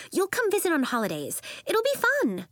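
high, quick sisterly encouragement; upbeat, high-pitched coaxing; cheery reassurance. A narrator's reading. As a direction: sell it brightly in a high voice. Sister cheerily reassures upset child.

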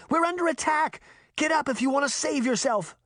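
high-pitched urgent warning; alarmed cry. A narrator's reading. Girl warns her sister of an attack, crying out. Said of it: "she cried".

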